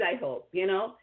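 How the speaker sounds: ordinary talk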